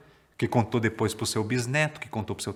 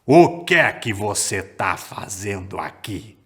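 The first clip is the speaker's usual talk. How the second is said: lower, louder, slow booming giant; 5 semitones lower, 5 dB louder, slower than usual. Acted. Giants questioning the little boy below, slow booming voice. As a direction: boom it down slowly from far above. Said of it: "olharam pro menino lá embaixo e falaram".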